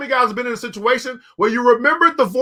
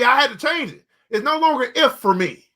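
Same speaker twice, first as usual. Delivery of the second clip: ordinary talk, nothing marked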